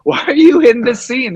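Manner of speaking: high, loud, laughing disbelief; hammed-up amazement, laughing through the words; retold baffled question. Telling podcast friends about a famous director, high laughing disbelief. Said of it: "I go".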